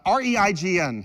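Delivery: normal talking voice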